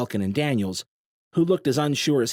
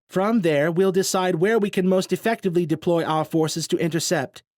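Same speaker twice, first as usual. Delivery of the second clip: confident commander; 9 semitones higher, 5 dB louder than usual. A narrator's reading. Daniels briefing the crowd.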